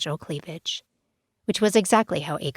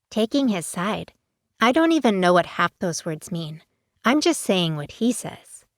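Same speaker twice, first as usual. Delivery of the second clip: put-on innocent teen; 2 semitones higher than usual. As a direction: play dumb, wide-eyed.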